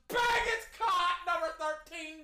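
high, punched exclamation; excited play call. Real, not acted. Calling another completed pass, excited high shout. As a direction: burst out with the catch call.

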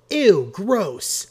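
high, loud squeamish exclamation; disgusted. A narrator's reading. Marcy Marcy seeing the used napkin, disgusted. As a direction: recoil from it in disgust.

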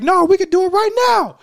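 loud, high, heated dare; fighting words. Retold or imitated. Dennis daring the football player to fight now, loud and high.

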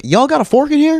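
imitated returning man. Man comes back asking for cutlery, imitated high voice. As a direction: put on the chicken grabber asking a favor. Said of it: "he comes back, he's like".

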